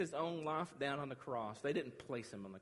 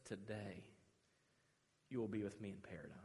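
low, soft assurance; hushed promise; 5 semitones lower, 8 dB quieter than usual. Acted. Jesus answering the dying thief, hushed and low. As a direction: give it quietly, with space after the first word.

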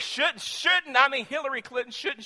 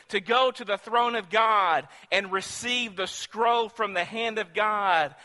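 rising preacher's cry, louder and higher; preaching; 5 semitones higher, 6 dB louder than usual. Acted. Preacher to the congregation, building louder. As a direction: build the sermon to its climax.

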